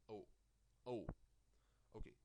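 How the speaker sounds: normal talking voice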